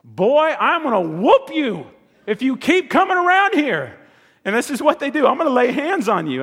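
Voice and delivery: high, loud mock threat to the boys; hammed-up angry dad shouting a threat; threatening dad. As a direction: play the angry father warning the boys off. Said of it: "Let me just translate that for you".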